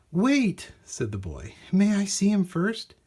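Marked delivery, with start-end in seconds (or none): 0.1-0.7 put-on high, eager boy's call. Boy calling out to the shopkeeper, eager. 1.7-3.0 put-on high, hopeful child's voice; eager boy. Boy asking the shopkeeper to see Clink, hopeful.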